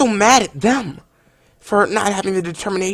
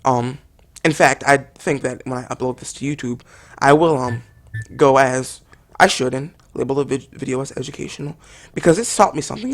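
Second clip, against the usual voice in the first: ordinary delivery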